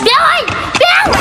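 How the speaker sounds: high, far-carrying call. Acted. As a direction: call the name from a distance. Calling out to a neighbour. (gọi với cao giọng)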